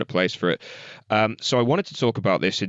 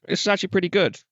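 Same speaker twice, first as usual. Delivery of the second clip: much higher-pitched retold reaction; pleasantly surprised; 12 semitones higher, much faster than usual. Critic recalling his first listen to the album, pleasantly surprised. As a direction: replay your surprise, pitched right up.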